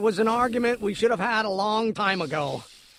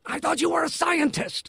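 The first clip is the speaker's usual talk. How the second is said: exasperated shout; 8 semitones higher than usual. Acted. Son shouting at his father in exasperation. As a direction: hurl it at him.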